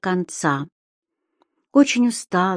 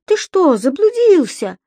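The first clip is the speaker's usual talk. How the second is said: much higher creature voice; Субастик; 10 semitones higher, 4 dB louder than usual. A narrator's reading. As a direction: ask high and teasing. (высокий голосок Субастика)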